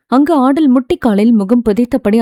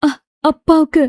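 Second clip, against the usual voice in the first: higher, stammered start of crying; breaking into tears; 4 semitones higher than usual. A narrator's reading. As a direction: voice cracking as she names her father. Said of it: "ஆடல் அழ ஆரம்பிக்கவும்".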